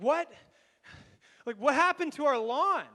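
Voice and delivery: the mother spotting the ruined lawn, shrill with alarm; shocked mom. Retold or imitated. Mom at the window discovering the burnt lawn, a loud high shocked cry.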